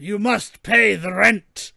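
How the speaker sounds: slow, hammy melodrama villain demand; mustache twirling villain. Pretend. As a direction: play the cartoon landlord villain, drawn out. Villain demands rent from victim.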